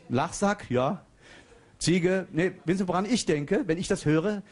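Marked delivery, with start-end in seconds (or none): none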